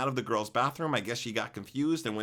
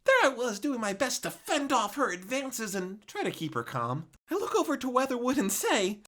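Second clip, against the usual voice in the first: ordinary delivery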